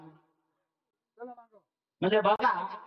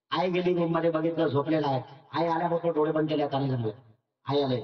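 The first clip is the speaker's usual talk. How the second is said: normal talking voice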